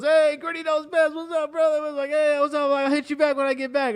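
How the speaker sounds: high-pitched buddy greeting; high, upbeat acted greeting; hailing a buddy. Acted greeting with security guard.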